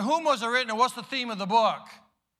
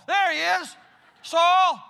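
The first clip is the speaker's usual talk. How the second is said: calling out; 7 semitones higher, 6 dB louder, slower than usual. Acted. Ananias calling out to Saul.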